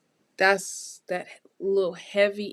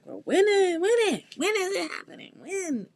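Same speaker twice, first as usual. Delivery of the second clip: high, fast, whiny mock impatience; impatient client; 10 semitones higher than usual. Reader imitating the client demanding when, high and impatient. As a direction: mimic a client pestering for answers. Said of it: "because you're like".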